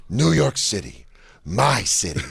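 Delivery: slow mob-boss pronouncement; Travolta as Gotti. Retold or imitated. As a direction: claim it grandly, pausing between phrases. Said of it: "it's Travolta as Gotti".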